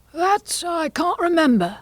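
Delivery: very high, slow, nervous admission; Hatter. Acted. Hatter admits to forgetting.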